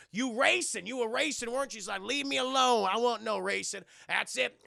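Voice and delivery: hammed-up indignant family row; scolding dad. Dad catching his son racing, mock angry scolding. Acted father angrily confronting son.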